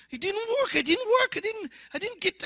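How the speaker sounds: high, whiny disbelief; disappointed inner voice. Retold or imitated. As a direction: squeal it out like a let-down kid. Inner disappointment after the crusade.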